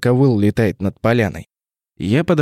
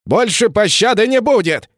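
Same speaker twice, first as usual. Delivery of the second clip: angry vow; 10 semitones higher than usual. Acted. Emissary vows no mercy.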